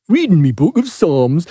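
lightly mimicked commentator. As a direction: echo the commentator's announcement in a put-on voice. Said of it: "that commentating voice goes".

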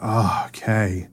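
soft, slow exhaled relief; sigh of relief. Retold or imitated. Speaker retelling his soul coming home, sighing with relief. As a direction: let it out as a long, quiet sigh. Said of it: "breathed a sigh of relief".

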